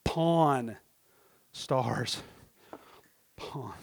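"Pawn" and "Stars" apart, each drawn out; real slow. Shown as a demonstration. Preacher clarifies show's name, real slow "Pawn Stars". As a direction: say "Pawn", pause, "Stars", low and careful. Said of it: "I'm gonna say it real slow".

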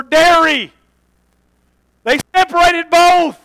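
loud preacher hammering it home; full-force preaching. Real, not acted.

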